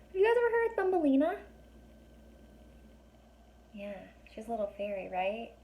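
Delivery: plain reading voice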